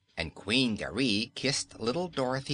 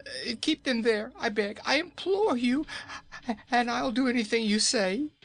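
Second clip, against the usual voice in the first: frightened pleading; 14 semitones higher than usual. Acted. Nome King begging Dorothy, teeth chattering with fear. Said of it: "pleaded Kaliko, his teeth chattering".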